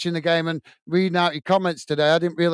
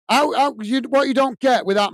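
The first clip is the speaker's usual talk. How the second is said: higher, rising heat, really telling him off; heated rant; 7 semitones higher than usual. Real, not acted. Scolding a commenter for mocking League One, heated rant.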